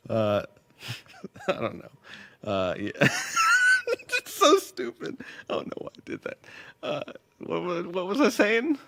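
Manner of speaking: laughing fit. Real, not acted. Giggle fit on stream.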